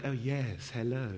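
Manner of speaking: a hint of a soft, pious pastor; lightly mock a hushed churchy greeting. Mates teasing the preacher's soft pious voice. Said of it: "they call it my Pastor Luigi voice".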